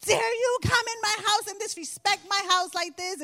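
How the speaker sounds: high, loud outraged shout; furious shout. Retold or imitated. Mother yelling at the daughter in the dream, furious shout. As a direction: blow up at the intruder. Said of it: "I was like".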